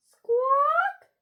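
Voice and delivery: very high, loud squawk; bird squawk. A narrator's reading. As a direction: screech the word like a bird. Imitates a bird's loud noise.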